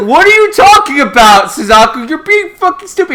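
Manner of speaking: high, loud, incredulous telling-off; mock exasperated scolding. Lelouch to Suzaku, hammed-up exasperated scolding. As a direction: berate a foolish friend in acted outrage.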